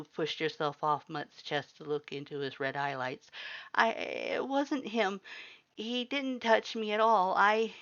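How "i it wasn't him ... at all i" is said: high, slow, shaky pleading; distressed pleading. A narrator's reading. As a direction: insist through distress. Human protests, distressed.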